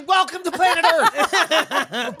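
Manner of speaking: high, teasing exasperation; mock incredulity. Real, not acted. Mocking friend's odd pronunciation.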